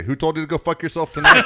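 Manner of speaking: loud, high, ribbing jab; teasing ballplayer. Retold or imitated. Star player ribbing a young reporter, loud and teasing. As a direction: needle him loudly like a veteran teasing a rookie. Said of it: "he would say".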